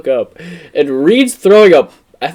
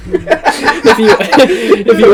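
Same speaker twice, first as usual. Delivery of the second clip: laughing; 7 semitones higher, slower than usual. Real, not acted. Laughing fit mid story.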